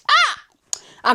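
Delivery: sudden high exasperated Ah; shocked outcry; exasperated cry. Real, not acted. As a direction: throw up your hands with a high Ah. Exasperated outburst.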